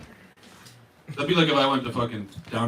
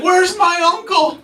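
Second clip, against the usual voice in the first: drawn-out, high-pitched holler; homeless yelling; 9 dB louder, slower than usual. Retold or imitated. Homeless man yelling out on the street, loud holler. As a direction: yell it out slowly, pitched way up. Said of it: "homeless people would be yelling shit out".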